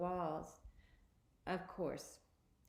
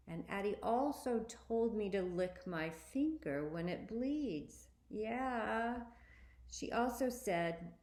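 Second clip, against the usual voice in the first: ordinary delivery, nothing marked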